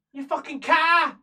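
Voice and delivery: high, loud, drawn-out insult; really angry. Acted. Angry prisoner breaking three days of silence at her cellmate, furious outburst. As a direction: burst out in acted fury. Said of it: "that means she's really angry".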